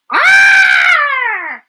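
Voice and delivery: high loud scream of alarm; startled scream. Acted. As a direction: scream in sudden fright. Bird spots cat, screams.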